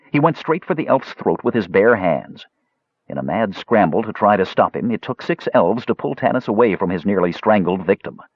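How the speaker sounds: ordinary delivery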